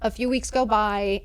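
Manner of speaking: ordinary delivery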